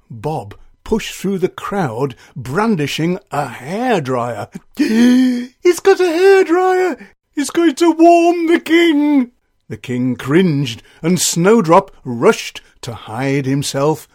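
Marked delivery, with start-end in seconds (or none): 4.5-5.6 sharp loud gasp; crowd gasp. Acted. Crowd sees hairdryer. 5.6-9.3 much higher, loud frantic cry; alarmed crowd voice. Acted. Crowd voice cries out.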